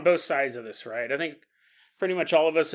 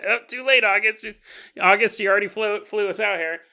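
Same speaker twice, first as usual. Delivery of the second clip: ordinary talk, nothing marked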